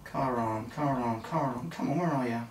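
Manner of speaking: soft, absent-minded repetition while searching; muttering to self. Real, not acted. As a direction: murmur it as you scan the page. Searches rule book, muttering to self.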